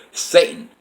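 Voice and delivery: normal talking voice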